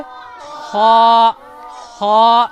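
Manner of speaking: खा from the throat, rasping; Arabic kha. Shown as a demonstration. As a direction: rasp खा out of the middle throat. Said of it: "कैसे निकले?".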